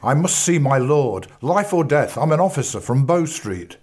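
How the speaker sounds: insist, life or death; urgent demand. Acted. Bradbury demands to see the Minister, urgent.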